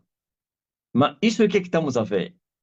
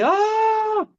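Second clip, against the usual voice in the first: high mock shout from the mountaintop; retelling his mountaintop cry in a high shout. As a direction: call out high like shouting from a peak. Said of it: "Então eu cheguei e gritei".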